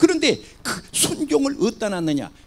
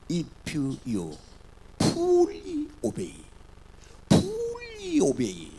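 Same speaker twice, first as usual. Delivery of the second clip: very slow and high, each word drawn out; slow emphatic English; 8 semitones higher, 3 dB quieter, much slower than usual. Shown as a demonstration. Preacher reading the English Bible to the congregation, very slow and drawn out. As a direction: stretch the words out to teach them. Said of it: "fully obey가 뭐냐".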